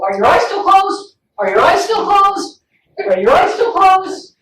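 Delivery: put-on high, insistent kid checking up; sing-out kid voice, repeating urgently; pestering child. Acting kids checking therapist's eyes.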